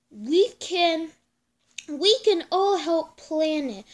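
plain reading voice

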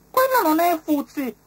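very high, loud ladybird voice; Mrs Ladybird's voice. A narrator's reading. Ladybird refusing to believe it, very high. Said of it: "disse la signora Coccinella".

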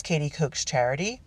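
usual speaking voice

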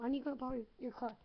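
ordinary talk, nothing marked

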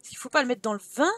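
normal talking voice

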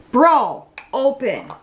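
exasperated at the lid; loud, fed-up plea to the lid; frustrated. Real, not acted. Struggling to open bronzer.

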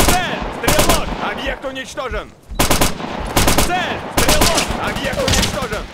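sharp, loud combat shout; shouted callout. Acted. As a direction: bark it across the battlefield.

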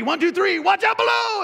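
high, loud warning holler; rising into a high shout; shouted countdown. Acted. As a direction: count it off and yell the warning below. Counting down before the drop.

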